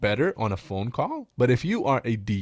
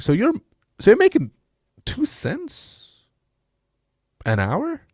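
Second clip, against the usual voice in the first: mock disbelief; 12 semitones higher, 5 dB louder, slower than usual. Mock shocked reply to lead.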